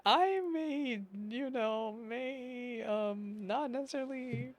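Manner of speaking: high, coy mock voice, slow; paraphrasing Arlecchino. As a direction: play her as coyly hinting. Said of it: "It almost seems like she's saying".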